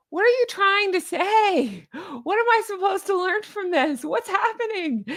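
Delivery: very high, comically desperate bewilderment; mock exasperation. Confused listener to the guest, exasperated high-pitched wail.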